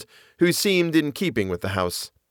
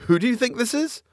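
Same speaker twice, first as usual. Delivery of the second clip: laughing; 13 semitones higher than usual. Acted. Laughing at the mistake.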